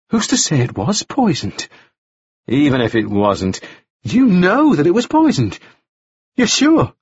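woman throwing doubt back at her accuser; challenging woman character. A narrator's reading. Woman questioning the man about poisoned tar, challenging.

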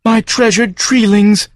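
high, slow anguished creature cry; grieving farm creature. Acted. The farm wailing at Joe over its trees, high slow grieving creature. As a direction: mourn the saplings.

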